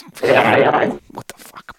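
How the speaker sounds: slow, breathless whisper; whispered aside in disbelief. Real, not acted. Aside about the Saints draft.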